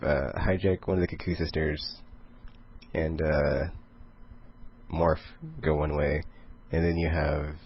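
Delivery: normal talking voice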